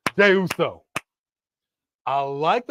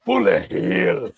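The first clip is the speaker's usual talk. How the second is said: slow, loud mock Scottish accent; 6 semitones higher, 4 dB louder, much slower than usual. Shown as a demonstration. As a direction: bellow it in a hammy Scottish accent. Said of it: "You were supposed to do that in a Scottish accent".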